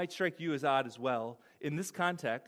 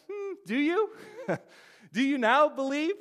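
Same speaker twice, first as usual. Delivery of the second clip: doubtful Jesus; 9 semitones higher, 3 dB louder than usual. Acted. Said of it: "Jesus answered them".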